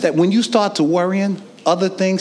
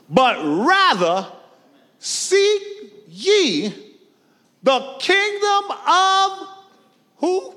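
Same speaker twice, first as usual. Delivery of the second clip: very high, slow, ringing call; preached call-and-response; 11 semitones higher, much slower than usual. Acted. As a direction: lift the verse and wait for the answer.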